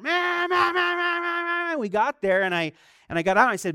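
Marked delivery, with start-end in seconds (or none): 0.0-1.8 imitated car horn. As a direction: honk like an angry driver leaning on the horn. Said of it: "I just hear this horn".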